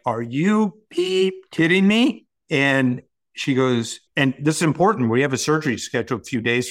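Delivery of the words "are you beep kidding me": higher, slow cry of disbelief; can't believe there's still this long to go; incredulous outburst. Retold or imitated. Patient in MRI told seventeen minutes left, incredulous. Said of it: "And I'm like".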